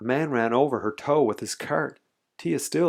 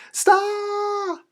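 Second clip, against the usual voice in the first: put-on very high, drawn-out child's scream; piercingly high yelled protest; loud yell; 4 dB louder than usual. A narrator's reading. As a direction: shriek it and hold the vowel. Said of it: "Tia yelled very loudly".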